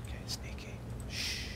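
soft unvoiced whisper. Real, not acted. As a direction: hush it while sneaking. Sneak attack.

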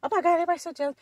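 very high, put-on whine; mock complaining. Narcissist complaining about jealous women, high mocking voice. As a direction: mimic a self-important complainer, voice way up. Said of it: "complain about bitches being jealous".

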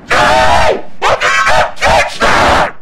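high, stretched-out yell at the screen; slow, high gamer yell; frustrated yell. Real, not acted. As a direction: howl it out in disbelief. Yelling at the game.

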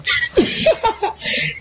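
high, breathless giggle; high laugh; amused laugh. Real, not acted. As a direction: crack up at a friend's outburst. Laughing at the rage outburst.

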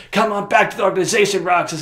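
high, loud beckoning; coaxing call. Acted. Friend summoning him back to the organization, coaxing call. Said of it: "he's like".

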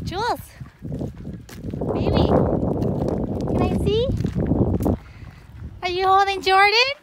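loud, high sing-song calling. Real, not acted. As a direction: call across to the little one.

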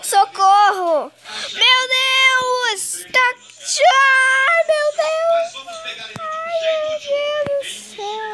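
high frantic cries; screaming while falling. Real, not acted. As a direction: yell for help in panic. Falling, crying out for help.

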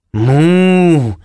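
imitated cow's moo, long and drawn out; urgent moo. A narrator's reading. Sea cow warning the narrator, urgent mooing. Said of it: "un tono urgente".

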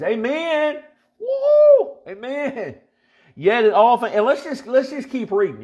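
high, loud amen and woo; excited whoop. Real, not acted. Preacher caught up in the verse, whooping joyfully. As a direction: burst out in joy.

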